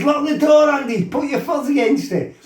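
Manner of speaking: high loud panicked order; panicked shout. Retold or imitated. Telling his mate to barricade the bathroom, high panicked shout. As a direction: bark it while holding the door.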